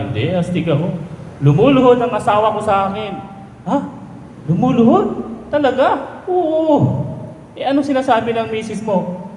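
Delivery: drinking buddies marveling at a kneeling wife, high and quick; high excited buddy voices. Acted. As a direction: play the gossiping friends, wide-eyed. Buddies' exchange in joke.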